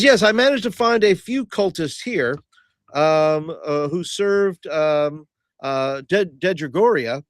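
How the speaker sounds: formal adventurer announcing findings; proud Hobbs. Acted. Player speaks as Hobbs.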